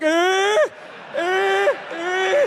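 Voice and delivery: high, loud held "eh" noises; mock shower squeal. Comic acting out showering with a loaded gun, high mock squeals. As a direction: squeal it out in short, rising bursts. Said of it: "load it and shower with it? Like".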